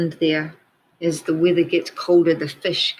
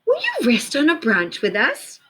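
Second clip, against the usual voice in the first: higher, light birdlike voice; bird voice; 8 semitones higher than usual. Acted. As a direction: invite him like a little bird. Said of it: "said the bird".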